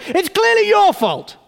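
jab every your; angry blame. Acted. Someone in debt blaming God, angry and loud.

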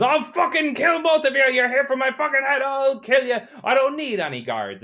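high furious screaming threats; battle scream. Acted. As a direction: shriek like you're charging with two swords. Charging enemy screaming threats.

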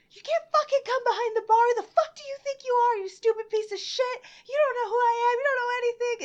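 high, loud, furious screaming rant; acted losing it. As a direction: yell like you've completely lost it, reenacted. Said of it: "I said, I just lost it".

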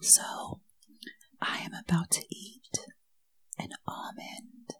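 soft, breath-only whisper; hushed mock-sensual announcement; ASMR whisper. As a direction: whisper it slowly into the mic. Second, whispered ASMR attempt.

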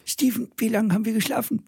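verstellte Stimme. Pretend. Kokon-Witz, verstellte Stimme.

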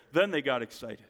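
usual speaking voice

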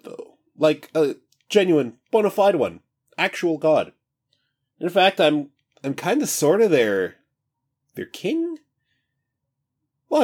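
high, breezy, carefree god; casual new voice. Acted. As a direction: stretch and chat like just woken. God king rambles casually.

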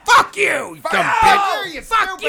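loud, high hammed-up shout; mock fury. Host screaming mock rage at the pen pal, in a loud high shout.

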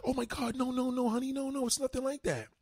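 high-pitched mock pleading girlfriend; pleading woman. Imitating woman pleading with boyfriend.

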